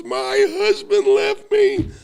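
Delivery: high, slow, loud mock sobbing wail; imitating tearful wail. Neighbor tells him her husband left, mock wailing. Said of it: "she burst into tears and just goes".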